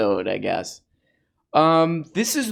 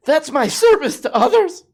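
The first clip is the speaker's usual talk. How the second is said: much higher, louder mock-proud declaration; sarcastic punchline; 13 semitones higher, 5 dB louder than usual. Mocking paid pundits, sarcastic high proud punchline. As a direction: sell the line with fake pride.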